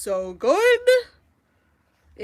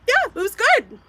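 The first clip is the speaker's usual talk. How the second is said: much higher, mock unimpressed husband voice; imitating husband; 12 semitones higher, 7 dB louder than usual. Wife mocking husband's unmoved reaction, high mock voice. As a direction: mimic a shrug of a reaction. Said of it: "It was literally like".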